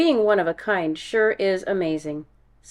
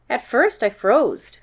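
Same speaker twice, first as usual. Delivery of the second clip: ordinary delivery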